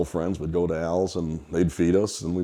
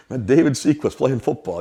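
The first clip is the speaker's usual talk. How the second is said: called back answer; 7 semitones higher, 6 dB louder than usual. Retold or imitated. Boy calls back answer.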